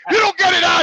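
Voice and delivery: loud, high-pitched threat; retold angry warning. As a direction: act out a fed-up patient's warning. Retelling warning to the needle man.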